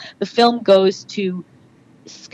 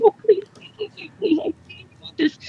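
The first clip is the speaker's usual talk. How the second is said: high, hushed begging, trailing softer; desperate pleading; 10 semitones higher, 4 dB quieter than usual. Retold or imitated. Actress wishing for the part at night, high desperate pleading. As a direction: plead under your breath in a high voice.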